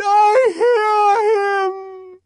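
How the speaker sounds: even longer high, loud wail; frenzied, held-out shriek; ecstatic cry. A narrator's reading. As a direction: stretch every word into a high cry. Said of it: "she cried".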